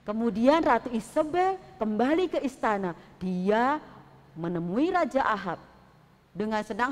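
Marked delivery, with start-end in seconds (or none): none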